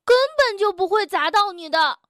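high, quick, defensive protest; stubborn retort. A narrator's reading. Tiaotiao denies the danger to Bengbeng, stubborn. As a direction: insist stubbornly, refusing to admit fault. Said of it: "嘴硬地咕哝了一句".

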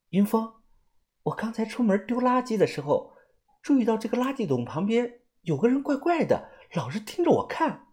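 high, soft voice of a worried companion; uneasy female companion. Acted. Companion telling Yunfeng about a strange watcher, uneasy, high and soft.

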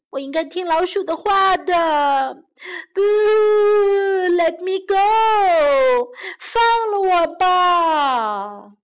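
high, drawn-out mock crying; loud storybook boo-hoo pleading; wailing frog. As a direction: sob and beg for release, hammed up. Caught frog wails for release.

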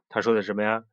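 ordinary delivery, nothing marked

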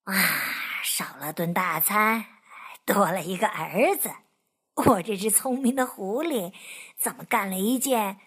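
low rueful inner voice; fox thinking aloud. Acted. Fox wondering at himself, low and rueful. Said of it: "狐狸想".

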